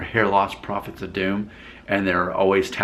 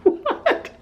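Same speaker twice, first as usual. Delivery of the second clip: laughing. Real, not acted. Reacting through laughter.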